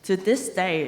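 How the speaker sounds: usual speaking voice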